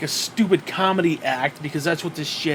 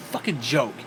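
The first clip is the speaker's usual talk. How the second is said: ordinary talk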